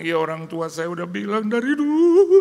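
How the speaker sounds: high, loud put-on voice of the distressed wife; wife's voice. Wife answering the pastor, high and loud. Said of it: "Terus dia bilang".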